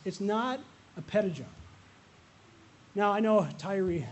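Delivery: ordinary delivery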